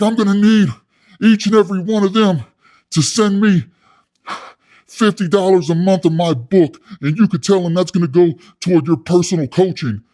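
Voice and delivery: high, loud, emphatic phrases with pauses; loud demand. Retold or imitated. Inmate demanding fifty dollars a month, loud and pushy. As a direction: shake him down as the pushy inmate. Acting out cellmate demanding money.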